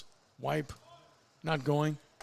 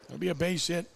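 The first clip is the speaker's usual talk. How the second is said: usual speaking voice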